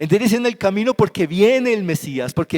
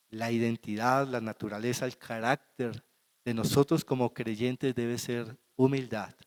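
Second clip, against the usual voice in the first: soft, low, intimate preaching; hushed sermon; 7 semitones lower, 10 dB quieter than usual. Acted. Pastor gently teaching the church about humility, hushed. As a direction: draw the church in close and quietly. Quiet reflective sermon opening.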